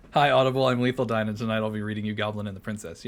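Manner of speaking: loud mock audiobook host; audiobook intro. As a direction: announce it like an Audible narrator.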